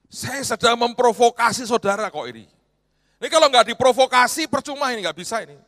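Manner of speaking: normal talking voice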